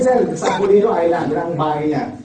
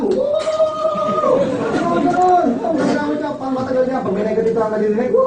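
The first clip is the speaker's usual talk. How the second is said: higher, louder excited whoop; mock whoop; 11 semitones higher, 5 dB louder than usual. Speaker showing how to react to negativity with a loud whoop. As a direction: hype it up like a crowd.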